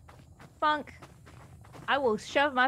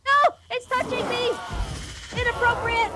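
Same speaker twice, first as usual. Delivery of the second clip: panicked shrieking; 10 semitones higher than usual. Real, not acted. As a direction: shriek in mock outrage. Monster grabbing player character.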